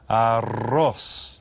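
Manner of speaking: "Arroz" with a long trilled rr; rolled double R. Shown as a demonstration. Teacher modelling the double R for class, rolled R. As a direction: roll out the rr in "Arroz". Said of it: "roll that double R".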